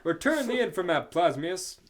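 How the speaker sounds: loud heroic demand; shouting. Acted. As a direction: call it out to the villain. Hero yelling at villain, reread.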